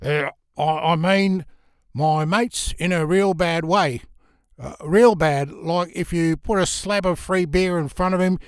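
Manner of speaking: an Aussie bluff, pushed harder this time; fake Australian accent. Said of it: "tried using his fake accent again".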